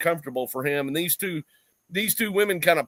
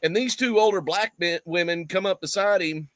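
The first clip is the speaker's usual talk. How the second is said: normal talking voice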